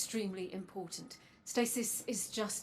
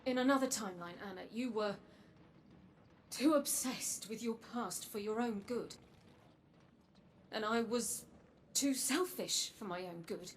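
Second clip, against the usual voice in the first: quiet, halting confession with long pauses; soft confession; 9 dB quieter than usual. Acted. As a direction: confess it softly, piece by piece. Soft confession and apology to Anna.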